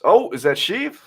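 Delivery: ordinary delivery, nothing marked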